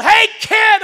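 loud, high call down the driveway; yell. Retold or imitated. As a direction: holler after the kid. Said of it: "And he yelled".

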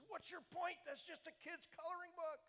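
high, loud scornful challenge; defiant yell. Retold or imitated. Imitating the heckler staring him down, loud high scorn. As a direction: throw the challenge right in his face. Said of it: "He says, he's looking right at me".

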